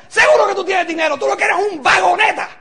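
mock scolding. Speaker shows how not to answer a recruit, mock angry scolding. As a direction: lay into them loudly, as an example. Demonstrates insulting someone instead of tact.

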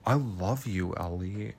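low, very soft declaration; retold tender confession. Jake confesses love to Ellie, retold tender confession. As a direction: imitate him lowering his voice to confess love.

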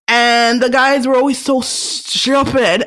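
put-on a light Trini accent on "stupid", slow; lightly imitating mom's Trinidadian accent, slow. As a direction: drag it out Trinidadian-style like mom. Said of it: "in the way my mom would say it, with a Trini accent".

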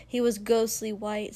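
ordinary delivery

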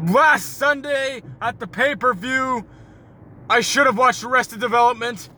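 high, loud, slowed hammed-up wrestler shouting; eighties wrestler promo. Answering how was your day as a wrestler, hammed-up loud promo. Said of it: "professional eighties wrestler in a promo".

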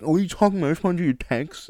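high, loud imitation of protest; mock indignant denial. Friend denying she ignores texts, mock indignant. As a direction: mimic an incredulous, defensive friend.

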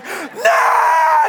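high, stretched-out wail of refusal; imitated toddler scream. Toddler picked up mid-tantrum, screaming refusal. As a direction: scream it like a limp toddler protesting. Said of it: "He's, like, screaming".

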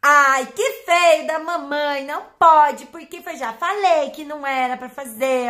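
high, loud, slow sweet-voiced scolding of a pet; mock owner gently scolding her dog; scolding with affection. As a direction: tell the dog off like cooing to a baby. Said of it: "dar bronca com carinho".

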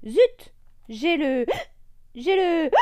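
high voice broken by hiccup gasps. Acted. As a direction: gasp between words like hiccups. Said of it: "dit le perroquet". (avec le hoquet)

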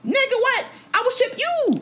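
very high, incredulous mock outrage; defiant comeback. Daughter turning the shipping threat back on parents, defiant. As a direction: squeal it back as an indignant counter-threat.

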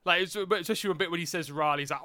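ordinary delivery

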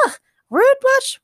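very high, loud, slow indignant splutter; spluttering indignation. A narrator's reading. Morgana spluttering at Keith after the cold water. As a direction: splutter it, soaked. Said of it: "Morgana spluttered".

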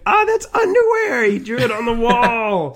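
high, loud gasping bystander voice; a passer-by gasping at wall art; mock onlooker. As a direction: squeal it like an amazed passer-by. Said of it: "everybody would be like".

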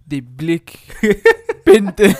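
exaggerated African accent breaking into laughter; mock African accent. Mocking worst accents ever, laughing African accent. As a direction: crack up through a bad African accent. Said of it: "Worst accents ever in a movie".

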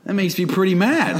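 imitated feigned anger. Acts SpongeBob feigning anger at insult.

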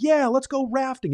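high, mock-excited group cheer; cheering students. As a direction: imitate the kids' eager whoop. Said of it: "everybody's like".

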